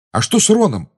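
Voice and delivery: high, loud worried question; anxious. A narrator's reading. Harry asks about his friend Ron, anxious. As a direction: ask in sudden alarm. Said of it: "тревожно спросил Гарри".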